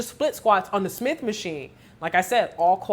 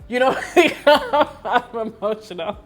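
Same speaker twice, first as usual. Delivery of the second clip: laughing it off, louder; a real laugh through the words; laughing at herself; 3 semitones higher, 6 dB louder, slower than usual. Real, not acted.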